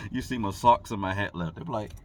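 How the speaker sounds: ordinary delivery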